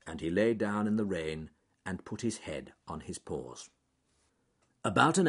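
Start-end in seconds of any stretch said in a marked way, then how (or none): none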